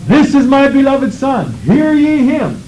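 lifted high, like a voice calling down from the sky; voice from heaven. Acted. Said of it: "what did the voice say".

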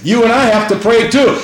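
normal talking voice

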